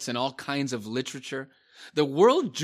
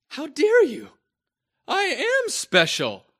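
high, loud indignant whine; offended whiny voice; 13 semitones higher, 4 dB louder than usual. Retold or imitated. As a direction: play the outraged special snowflake. Mocking offended churchgoer.

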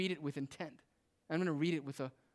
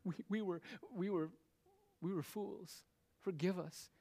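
high, stammering mock plea for mercy; higher-pitched, halting, fearful begging; groveling; 5 semitones higher than usual. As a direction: act out cowering brothers begging forgiveness. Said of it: "His brothers come groveling".

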